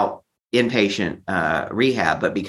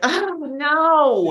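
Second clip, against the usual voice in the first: high, panicked cry of dismay; mock panic. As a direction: yelp it out like you've just been caught. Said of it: "I was like".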